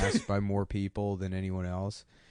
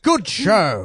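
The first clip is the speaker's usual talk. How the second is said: mock British official; 9 semitones higher, 8 dB louder than usual. Guinness officials approving the record.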